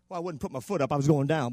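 ordinary talk, nothing marked

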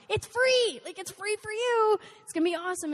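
it's free, make it sound irresistible; eager pitch. Retold or imitated.

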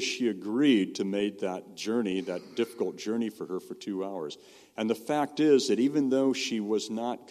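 usual speaking voice

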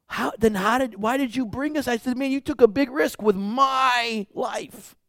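high-pitched, agitated questioning; retold exasperation, high but not shouted; incredulous protest. Shaken narrator confronts the missionary.

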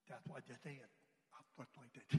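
low, soft grumble to himself; private aside. Retold or imitated. Man silently reacting to the leader's question, muttered aside. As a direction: drop it way down as a thought.

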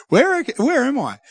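retelling own puzzled question, up high; confused. Retold or imitated. Checking GPS by the river, lightly acted bewilderment.

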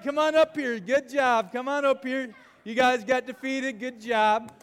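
ordinary talk, nothing marked

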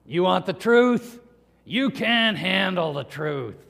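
gnarly, raspy, slow movie growl; Jack Nicholson. Acted. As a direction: rasp it out slowly and forcefully. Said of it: "in his gnarly, raspy voice".

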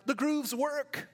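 put-on a young man marvelling that the trick works; amazed. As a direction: say it like a wonderful discovery. Amazed discovery.

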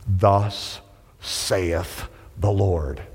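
low, very slow solemn scripture voice; solemn pronouncement. Acted. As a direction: intone it slowly and gravely.

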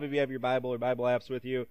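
ordinary talk, nothing marked

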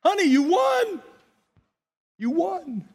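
high, lightly voiced nurse echoing the cry; imitating the nurse. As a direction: mimic the nurse joining in, amazed. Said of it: "The hospice nurse is like".

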